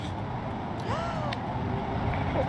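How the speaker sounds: high sharp shocked gasp. Real, not acted. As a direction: suck in air in shock.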